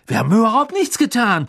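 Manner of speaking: high, heated protest; indignant denial. A narrator's reading. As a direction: protest your innocence hotly. Ron indignantly denies the accusation.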